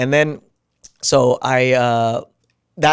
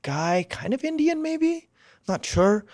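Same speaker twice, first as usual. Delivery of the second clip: unsure retelling; 12 semitones higher, 3 dB quieter, slower than usual. Retold or imitated. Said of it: "there was this".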